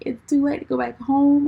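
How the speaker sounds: high, loud worried plea; mock panic. Driver tells her sister it's too late to turn back, mock panic.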